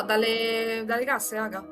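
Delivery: ordinary talk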